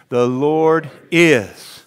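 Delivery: very slow, each word stretched; slow drawn-out echo. Shown as a demonstration. Pastor leads the congregation, slow drawn-out echo. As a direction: draw it out for them to follow.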